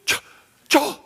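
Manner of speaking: high, loud disbelief building to a shout; stunned stammer bursting into a shrill cry of amazement; excited shout. Retold or imitated. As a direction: act out a friend shouting in giddy shock. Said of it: "he just shouts it out".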